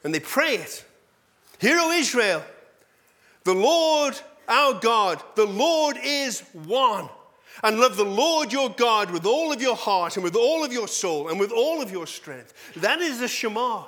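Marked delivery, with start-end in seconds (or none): 1.6-12.5 recited prayer. Acted. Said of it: "And this is what it says".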